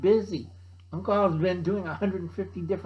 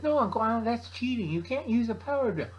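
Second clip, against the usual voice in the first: put-on lightly higher scolding kid; nephew voice; 7 semitones higher, faster than usual. Nephew scolds Uncle Alan for using a drill, mild kid voice. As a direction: tell him off like a know-it-all kid. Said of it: "and he says".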